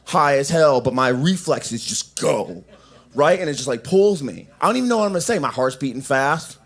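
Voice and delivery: normal talking voice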